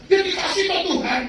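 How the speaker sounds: amused answer to the Lord; laughing reply. Retold or imitated.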